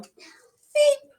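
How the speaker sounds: tiny high whispered beep; a small beast whispers back to its rescuer, high and hushed; creature beep. Acted. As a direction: breathe out a shy little creature squeak.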